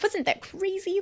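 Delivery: high-pitched giddy aside; excited. Retold or imitated. Bursting to share with a stranger in the next seat, excited. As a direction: gush it, high and eager. Imagined excited remark to seatmate.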